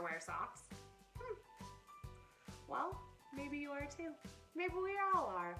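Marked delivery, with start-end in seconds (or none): none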